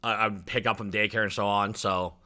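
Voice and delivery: ordinary talk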